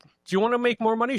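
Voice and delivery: high, fast TV-ad pitch; seventies TV ad. Retold or imitated. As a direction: sell it like an old commercial. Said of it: "That's a callback from the seventies".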